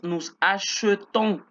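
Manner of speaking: slow "achetons" with middle E as French eu; careful demo of "achetons", E rounded to eu; muted E. Shown as a demonstration. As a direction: say "achetons" as a-sheu-TON, drawn out. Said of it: "Ya no pronunció la E, sino E".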